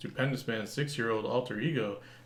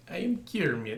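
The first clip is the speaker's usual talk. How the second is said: Russian Kermit impression; 4 semitones higher than usual. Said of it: "sounds like you with a Russian accent".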